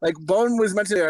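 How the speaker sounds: ordinary delivery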